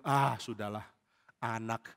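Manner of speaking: low, soft brush-off; weary dismissal. Acted. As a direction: wave off the flattery quietly. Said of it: "Daud sudah bilang".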